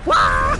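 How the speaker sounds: startled yelp. Real, not acted. Sudden yelp in game.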